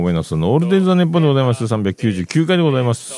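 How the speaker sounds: loud, fast title announcement with a lift; show title call. Acted. Radio host opening his show with a loud, fast title call. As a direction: belt out the show name like a radio opener.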